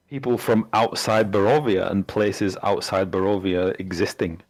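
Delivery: normal talking voice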